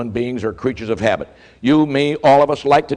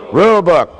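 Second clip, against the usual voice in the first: louder, laughing as you say it; laughing punchline; 5 semitones higher, 6 dB louder than usual. Real, not acted. Speaker to the audience, laughing as he gives the answer.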